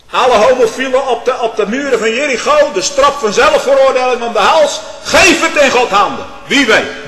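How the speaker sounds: very high, loud pulpit cry; shouted preaching. Acted. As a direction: hurl it from the pulpit. (schreeuwend preken)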